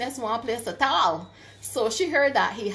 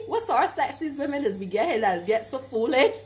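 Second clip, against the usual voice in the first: usual speaking voice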